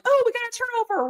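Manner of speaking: high, fast burst of fan hope; mock fan excitement. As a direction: blurt it like a hopeful fan. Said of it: "where you're like".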